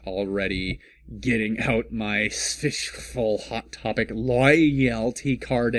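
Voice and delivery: ordinary talk, nothing marked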